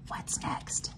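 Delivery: put-on hushed whisper asking the kids; whispered question. Teacher quizzing the children, soft whisper. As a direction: whisper it like a secret to the class.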